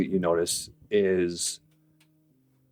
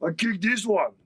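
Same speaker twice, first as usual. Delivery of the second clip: orc voice; 14 semitones higher than usual. Acted. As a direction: argue like a simple brute. Orc haggling over elves.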